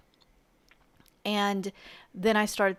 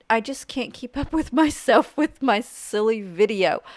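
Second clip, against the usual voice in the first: laughing; 3 semitones higher, 7 dB louder than usual. Real, not acted. Laughs at her rushed voiceover.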